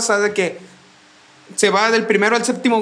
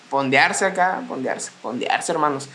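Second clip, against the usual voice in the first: slow low drawl; 6 semitones lower, 4 dB quieter, slower than usual. Pretend. Slow low mock chant.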